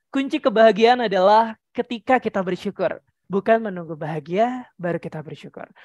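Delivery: descending voice-over quote; high then low. Shown as a demonstration. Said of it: "yang nada warna merah Kak Uta tinggiin".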